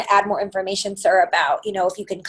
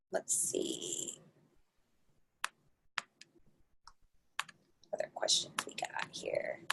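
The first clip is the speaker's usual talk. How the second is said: very soft, barely voiced aside; muttering to self; 19 dB quieter, slower than usual. Real, not acted. Webinar host scanning for more audience questions, muttering to self. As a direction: think aloud under your breath.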